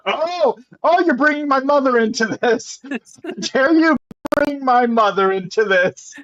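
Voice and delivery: high, hammed-up indignation breaking into laughs; mock outrage. Potion brewer offended over mother being mentioned, mock outrage.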